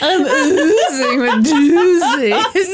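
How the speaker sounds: very slow, loud, laughing sultry voice; sexy voice. Pretend. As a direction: ooze it out seductively. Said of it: "people can't handle your sexy voice".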